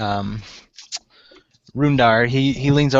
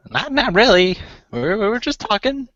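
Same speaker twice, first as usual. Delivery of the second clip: high-pitched, halting gnome voice; evasive gnome; 11 semitones higher than usual. Acted. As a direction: dodge the question in a high, hesitant voice. Gnome evasively answers question about secret.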